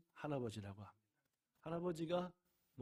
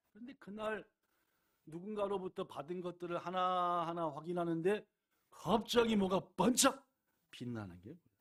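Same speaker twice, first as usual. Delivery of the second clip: ordinary delivery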